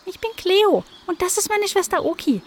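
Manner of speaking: a tiny cheeky kitten; kitten Cleo. A narrator's reading.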